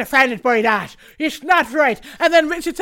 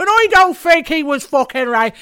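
louder, slower mock of the guest; mock Irish guest; 3 semitones higher, 8 dB louder than usual. A host mocking the Irish guest on TV, slow and loud. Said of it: "He said".

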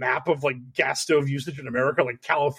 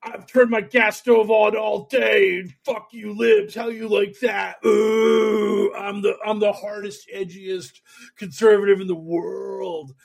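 mock Cat Turd tweet; 6 semitones higher, slower than usual. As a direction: ham up a smug online troll bragging. Mocking Cat Turd's tweet.

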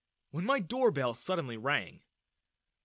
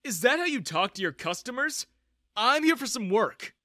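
raised, high-pitched protest; indignant; 9 semitones higher, 6 dB louder than usual. A narrator's reading. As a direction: stand up to the guard loudly. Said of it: "I glared at him".

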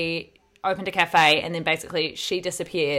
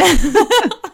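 loud high laugh; 13 semitones higher, 12 dB louder than usual. Real, not acted. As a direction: burst of laughter. Laughing at the story.

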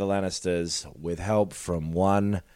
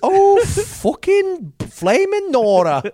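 higher, louder mock Scouse accent; Liverpool-ish accent; 5 dB louder, slower than usual. Arya griping about her dad, mock Liverpool accent. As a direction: ham up a northern English girl. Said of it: "a fucking Liverpool bullshit thing".

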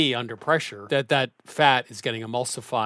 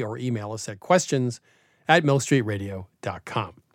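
ordinary talk, nothing marked

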